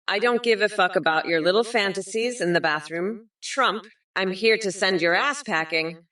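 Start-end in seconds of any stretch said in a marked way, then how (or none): none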